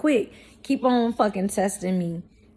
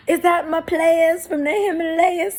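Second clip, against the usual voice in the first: high, strained put-on voice with a cry; hammed-up Martin bit, voice pitched way up; Martin impression; 8 semitones higher than usual. Doing Martin's goofy voice for her viewers. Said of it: "Martin goofy ass has said".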